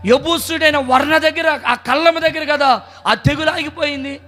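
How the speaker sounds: high, impassioned sermon climax; high fervent preaching over music; preaching at full force. Real, not acted. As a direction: drive the point home to the crowd.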